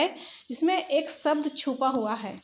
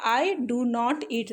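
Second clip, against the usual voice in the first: slow dictation; much slower than usual. Shown as a demonstration. Teacher dictating the model sentence.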